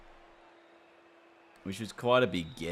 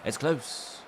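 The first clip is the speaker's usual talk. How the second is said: put-on lightly done British accent; 8 semitones higher than usual. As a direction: say it like a British commentator. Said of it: "They said in a British, British accent".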